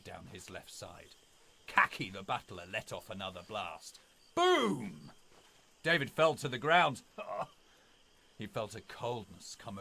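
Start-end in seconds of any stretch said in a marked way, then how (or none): 4.3-5.1 a huge shouted boom; booming gunshot. Acted. Narrator shouting the butler's shotgun blast.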